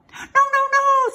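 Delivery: high, loud goose squawk; piercing goose protest; alarmed goose. A narrator's reading. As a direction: warn frantically as a goose. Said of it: "said the goose".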